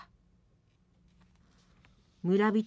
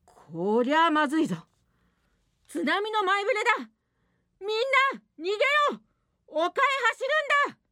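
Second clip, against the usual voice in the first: urgent shout; 10 semitones higher, faster than usual. Acted. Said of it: "村長が叫んだ。".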